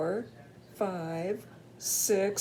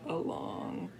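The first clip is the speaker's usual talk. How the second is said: whispering the end; hushed so the bear sleeps; whispered; 9 dB quieter than usual. Acted.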